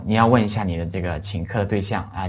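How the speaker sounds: ordinary talk, nothing marked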